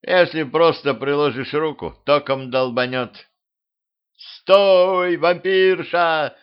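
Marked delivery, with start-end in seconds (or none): 4.2-6.4 high, loud drawn-out yell; shout. A narrator's reading. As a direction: call out to stop her, stretching the words. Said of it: "заорал, оборачиваясь, Петрович". (протяжный крик)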